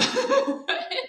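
high bright burst of laughter; laughing. Real, not acted. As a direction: laugh out loud. Laughs at own story.

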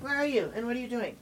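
high, soft dog talk. Real, not acted. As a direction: coo at the hiding puppy. Calling to her dog.

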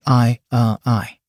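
halting, with hesitant breaks; stumbling over words. A narrator's reading. Man disbelieving his luck before a first kiss, stumbling. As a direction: falter as if words will not come. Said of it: "He stumbled over his words".

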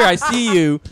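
ordinary talk, nothing marked

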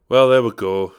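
usual speaking voice

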